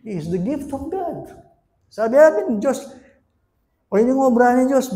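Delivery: ordinary talk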